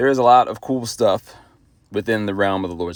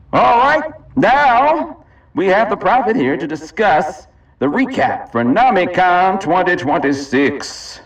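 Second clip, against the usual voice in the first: high, slow announcer call; announcer intro; 7 semitones higher than usual. Acted. Host introducing the prophet's recap, slow showy announcer. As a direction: announce the next guest with flair.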